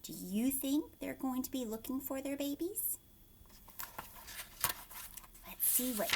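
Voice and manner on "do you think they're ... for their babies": plain reading voice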